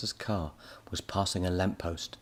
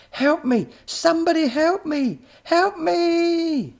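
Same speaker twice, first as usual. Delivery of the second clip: high, loud desperate screams; screaming for help; 10 dB louder, slower than usual. A narrator's reading. Professor dragged off by a pig, screaming high and loud. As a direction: scream for rescue. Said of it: "he screamed".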